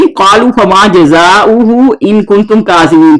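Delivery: slow, measured recitation of the verse; a teacher chanting the Arabic verse for students; Quran recitation. Shown as a demonstration. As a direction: recite it slowly, drawing out the vowels.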